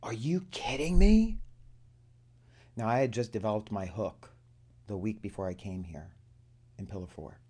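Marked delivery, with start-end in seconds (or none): 0.0-1.4 high, loud, slow "are you kidding me"; stunned excited exclamation; amazed disbelief. Retold or imitated. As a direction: act out her astonishment. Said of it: "her eyes got big and she goes".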